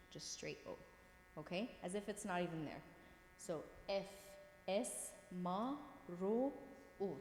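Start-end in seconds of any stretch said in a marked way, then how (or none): 0.1-0.8 a pure O, no glide; just the O sound; straight O sound. Shown as a demonstration. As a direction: say it as a plain straight O. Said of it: "this just becomes the O pronunciation".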